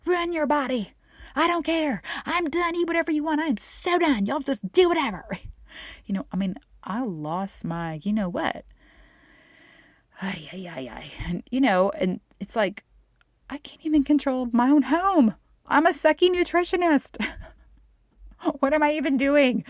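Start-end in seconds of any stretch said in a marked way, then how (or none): 0.0-5.3 much higher, louder fed-up yell; mother yelling at her kids about food, loud and fed up; exasperated yelling at kids. Retold or imitated. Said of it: "I was yelling at them". 13.5-19.6 higher, louder, despairing rant; frantic, much louder, higher venting; exasperated outburst. Real, not acted. Venting despair about failing.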